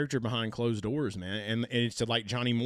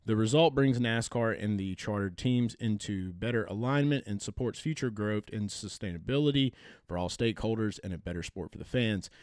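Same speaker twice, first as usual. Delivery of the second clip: usual speaking voice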